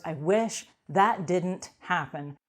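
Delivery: each word laid down slowly; slow deliberate phrase. Shown as a demonstration. Repeating the self-talk phrase slowly for the audience. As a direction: say it slowly, letting it land. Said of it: "When I say those words".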